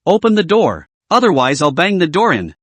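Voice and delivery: plain reading voice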